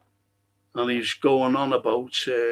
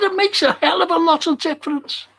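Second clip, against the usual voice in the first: much higher and louder indignant shout; angry retort; 5 dB louder than usual. Retold or imitated. Arguing with the fella, indignant shout. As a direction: hammer the point home furiously. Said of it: "went berserk".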